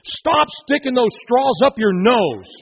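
half-play an angry dad yelling; father yelling at his boys, hammed-up; retold scream. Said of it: "screaming at his three boys".

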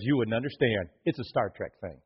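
a quiet, low dad shrugging it off; dad's dismissive reply. Retold or imitated. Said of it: "He goes".

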